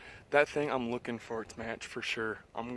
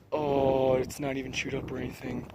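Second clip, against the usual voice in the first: ordinary delivery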